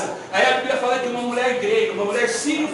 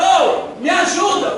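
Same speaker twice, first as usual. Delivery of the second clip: calling out for help; 6 semitones higher, 5 dB louder, slower than usual. Acted. Blind man crying out to Jesus.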